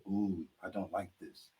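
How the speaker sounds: low, soft and slow, uneasy; wary friend. Retold or imitated. Filipino friend dreading the cruel jokes, soft and slow. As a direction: back away from the joke softly and slowly. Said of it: "He says".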